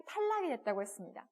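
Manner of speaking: normal talking voice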